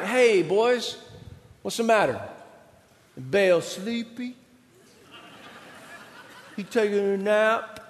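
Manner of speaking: slow, teasing mock sympathy. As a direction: taunt them like a bored bully. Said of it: "he starts mocking them".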